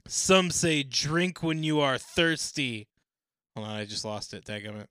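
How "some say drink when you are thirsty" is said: loud, high, very slow Aussie reading; drawn-out Australian voice, louder and higher; slow Australian accent. Acted. Reading a forum answer in a slow Australian accent. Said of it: "in my Down Under accent".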